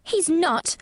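put-on high, indignant girl's cry; fiercely indignant girl. A narrator's reading. Girl defends the boy up the wall, fiercely indignant. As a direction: protest fiercely, short of a shout. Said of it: "almost shouting up the wall in her fierce indignation".

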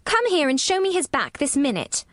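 put-on high, imperious little girl; commanding. A narrator's reading. Bossy girl ordering the nurse over, commanding. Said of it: "she commanded".

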